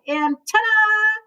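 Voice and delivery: high, slow showman flourish; grand ta-da. Acted. Moose presenting Turkey to his wife, drawn-out ta-da. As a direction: unveil it like a magic trick.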